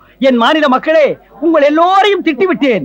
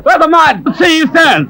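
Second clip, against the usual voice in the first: shouting after him; 3 semitones higher, much slower than usual. Acted. Chasing the man who cheated.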